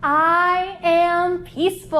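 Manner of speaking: slow, even, deliberate chant; calm affirmation. Shown as a demonstration. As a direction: say it slowly and evenly like a mantra. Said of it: "yoga pose affirmation".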